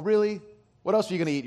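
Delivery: usual speaking voice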